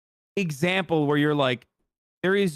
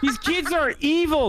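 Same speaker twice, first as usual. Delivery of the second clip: laughing; 12 semitones higher than usual. Real, not acted. Laughing disbelief at photo.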